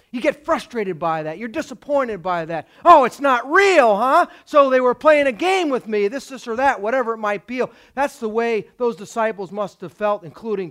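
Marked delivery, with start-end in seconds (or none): 2.8-7.6 raised, loud indignation at being fooled; indignant mock grievance; acted frustration. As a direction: complain like someone who's been duped. Voicing disappointed, fooled follower.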